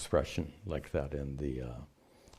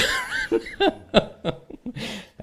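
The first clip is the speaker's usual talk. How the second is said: loud laugh; 5 dB louder than usual. Real, not acted. Laughing at own accent joke.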